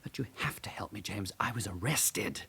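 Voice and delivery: hushed, urgent whisper begging for help; desperate whisper. Acted. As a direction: whisper it, afraid of being overheard. Desperate plea to friend.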